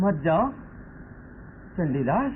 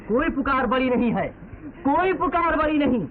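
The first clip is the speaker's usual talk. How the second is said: impassioned declaration; 7 semitones higher than usual. Acted. Son declaring humanity above all to his father, impassioned. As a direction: proclaim it with passion, pitch climbing.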